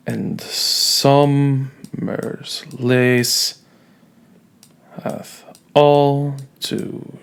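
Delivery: slow, lifted iambic read-through; scanning the meter. Shown as a demonstration. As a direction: tap out the iambs as you read. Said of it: "This is going to happen for all of these lines".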